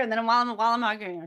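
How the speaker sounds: normal talking voice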